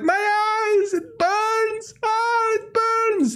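high, drawn-out mock pain; agonized wail. Butcher on the ground covering his eyes, agonized wail. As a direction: howl it high and slow, hammed-up.

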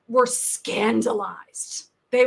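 ordinary delivery

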